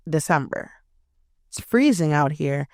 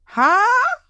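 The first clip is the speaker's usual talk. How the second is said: shocked huh; 5 dB louder than usual. Real, not acted. Shocked at his text.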